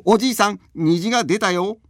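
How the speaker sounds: high, loud call across the room; calling out loudly. Acted. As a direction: shout it out to the old man. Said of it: "同時に大きな声で".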